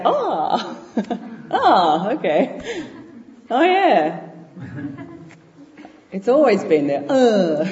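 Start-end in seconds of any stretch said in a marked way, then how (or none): 0.1-4.2 high, giggly ah, okay, oh yeah; laughing mock realization. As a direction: play at sudden enlightenment through laughter. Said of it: "you just say".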